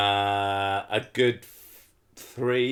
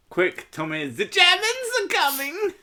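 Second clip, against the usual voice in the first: high-pitched mock-panicked film impression; Snatch film quote. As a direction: raise a comic false alarm, far higher. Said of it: "they're making a Snatch reference".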